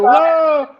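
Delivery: high, loud falling yell; imitated scream. Retelling what the audience heard in the dark, imitated scream. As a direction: cry out as if dropping off the stage. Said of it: "you hear".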